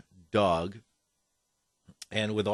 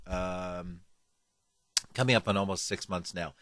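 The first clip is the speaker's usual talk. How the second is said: ordinary talk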